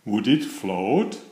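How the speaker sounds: plain reading voice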